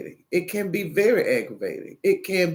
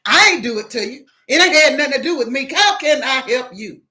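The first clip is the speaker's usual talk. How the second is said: fast, loud, higher comeback; snappy irritation; 5 semitones higher, 6 dB louder, faster than usual. Acted. Agent answering an upset caller, fast and testy. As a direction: fire back impatiently at the customer.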